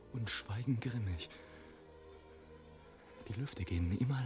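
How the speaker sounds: low, very soft suspense; hushed stillness. Acted. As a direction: sink towards a whisper as everything stops. Storm silent, air stands still.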